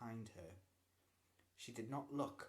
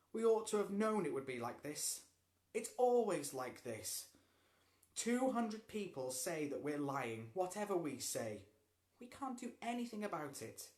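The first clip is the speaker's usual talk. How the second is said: miserably; 6 semitones higher, 6 dB louder than usual. A narrator's reading. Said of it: "Harvey said miserably".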